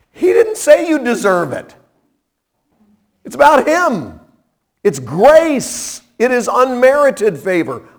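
high, ringing pulpit cry, lifted up to the rafters; fervent preaching. Acted. Preacher urging the radio listeners toward grace, in a ringing pulpit cry. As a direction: preach it out to the whole congregation.

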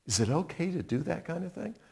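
soft, quickened aside; hesitant question. Acted. Squeamish listener questioning the killing, hesitant question. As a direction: ask it under the breath, doubtfully.

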